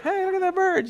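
very high cartoon animal voice; gawking animal. Animal pointing out the newcomers, high and gawking. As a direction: pipe up high, calling the others to look. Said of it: "and they're like".

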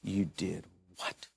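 hushed disbelief. Retold or imitated. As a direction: mutter it low and slow, appalled. Imagined scolding look voiced.

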